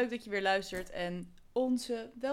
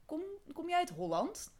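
put-on higher, curious child voice with rising end; little boy's question; 9 semitones higher than usual. Retelling a schoolboy asking the Dutch teacher, higher child voice. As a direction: ask it like a small schoolboy. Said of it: "die zei".

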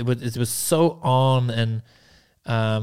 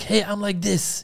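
high-pitched mock Ukrainian-accented English; imitated Ukrainian accent; 8 semitones higher than usual. Man quoting the girl he met, high imitated Ukrainian accent. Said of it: "in her crappy English 'cause, with this Ukrainian accent".

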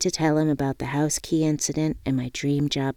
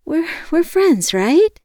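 high, loud, halting appeal; nervous; 13 semitones higher, 7 dB louder, slower than usual. A narrator's reading. Woman checks with friend on the phone, nervous. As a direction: stammer it, anxious for a yes.

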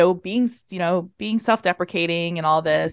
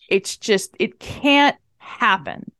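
usual speaking voice

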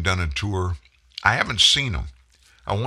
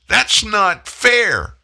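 high, slow whiny mockery; mocking whine; 12 semitones higher, 5 dB louder, much slower than usual. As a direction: whine it like a spoiled child. Mocking the left's whining.

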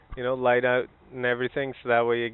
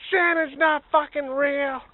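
much higher, louder, slow shout; loud reveal; 6 dB louder, slower than usual. Pretend. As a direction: tear the beard off and bellow it. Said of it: "be like".

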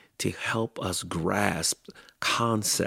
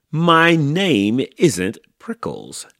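slow, loud, high protest; yelled back; 7 semitones higher, 8 dB louder, slower than usual. A narrator's reading. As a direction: yell back, dragging out the words. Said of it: "Hank yelled back".

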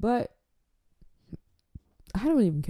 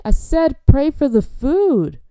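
very slow, high, loud outburst; exasperated shout; 7 semitones higher, 7 dB louder, slower than usual. Acted. Host fed up with fervent guest, exasperated drawn-out shout. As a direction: shout it drawn out over the praying. Final outburst over the praying.

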